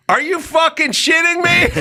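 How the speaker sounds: high, shouted disbelief; incredulous shout. Retold or imitated. Boss yelling at clueless worker in disbelief, high shout. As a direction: blow up at him. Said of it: "he was like".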